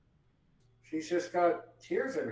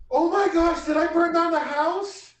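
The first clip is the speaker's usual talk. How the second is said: loud, high-pitched panicked outburst; snapping to in alarm; 13 semitones higher, 11 dB louder than usual. Acted. Character suddenly remembers the stove, high loud alarm. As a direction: snap out of the daze in a loud panic. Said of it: "and then she snaps".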